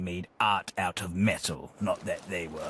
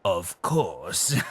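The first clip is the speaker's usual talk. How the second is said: ordinary talk, nothing marked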